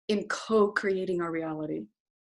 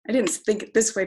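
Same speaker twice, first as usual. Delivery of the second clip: ordinary delivery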